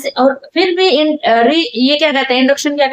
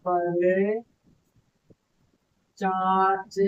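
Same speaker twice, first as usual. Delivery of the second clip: drawn-out words spoken as she writes; slow, quiet dictating pace; slow dictation; 4 semitones lower, 7 dB quieter, much slower than usual. Shown as a demonstration. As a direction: dictate slowly, word by word. Teacher slowly dictates while writing.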